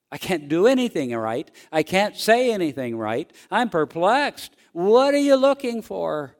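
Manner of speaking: lightly acted exasperation, pitch climbing; exasperated. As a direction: build from frustration to a high, loud plea. Acted outburst of perplexed person.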